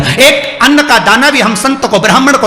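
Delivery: rising fervour. Real, not acted. Sermon listing holy recipients.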